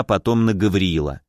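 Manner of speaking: ordinary delivery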